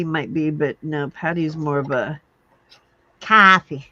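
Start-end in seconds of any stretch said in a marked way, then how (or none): 3.2-3.9 put-on loud Boston-accented coffee. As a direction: belt it out Boston style. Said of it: "Boston accent's a little bit different".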